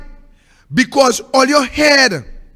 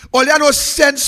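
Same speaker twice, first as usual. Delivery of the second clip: usual speaking voice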